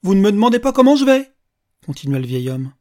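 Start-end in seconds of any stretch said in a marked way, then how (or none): 0.0-1.3 high, loud call to a stranger; raised-voice old man butting in; loud challenge. Acted. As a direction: pipe up loudly, demanding attention.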